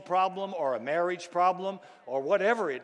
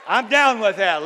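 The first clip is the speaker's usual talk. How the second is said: high, loud, wholehearted agreement; shouting back to God; 5 semitones higher, 12 dB louder than usual. Acted. Believer affirming God's promise in prayer, shouting loudly. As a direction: shout your approval with full force. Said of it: "That's what we shout back to God".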